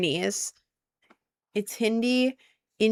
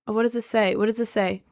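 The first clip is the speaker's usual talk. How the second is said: ordinary talk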